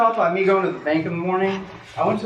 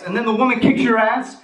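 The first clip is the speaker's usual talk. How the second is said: ordinary talk, nothing marked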